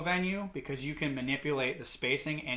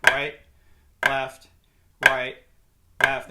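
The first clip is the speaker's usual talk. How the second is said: louder single words, evenly spaced; calling in rhythm; 7 dB louder, slower than usual. Shown as a demonstration. Drum teacher calling out the sticking while playing, in rhythm. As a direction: call each hand right on the beat.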